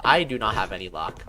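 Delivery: usual speaking voice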